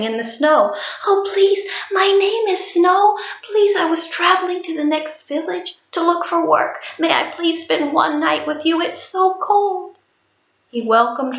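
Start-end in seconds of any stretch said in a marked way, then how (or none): 1.1-10.0 high, fragile plea for shelter; pleading young woman. A narrator's reading. Shivering stranger begging the son for shelter, high and pleading. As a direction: ask sweetly to be let in from the cold. Snow pleading at the son's door.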